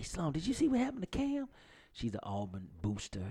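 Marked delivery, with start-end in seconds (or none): 0.0-1.5 put-on quoting the girl. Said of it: "one of the girls come and say".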